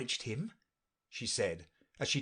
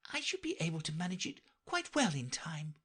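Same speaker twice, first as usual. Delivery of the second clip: put-on high, light girlish voice; Alice voice; 8 semitones higher, 3 dB quieter, faster than usual. A narrator's reading. Alice to herself, dreaming of being queen, in a high girlish voice. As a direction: read Alice eager and light.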